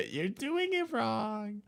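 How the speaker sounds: put-on high, slow whiny child voice; kid voice. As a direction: drag it out like a sulky kid. Quoting video kid.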